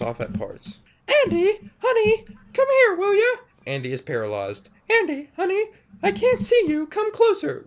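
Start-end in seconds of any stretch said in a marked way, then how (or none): 1.2-3.4 very high, sweet-creepy monster mother. Acted. Creature mother voiced in story. 4.9-7.6 very high, sweet-creepy monster mother. Acted. Creature mother voiced.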